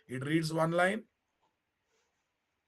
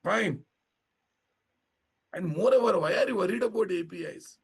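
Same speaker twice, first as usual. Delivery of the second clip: louder and higher; raised voice; 4 semitones higher, 5 dB louder than usual. Real, not acted. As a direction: speak up over the class. Teacher raising voice.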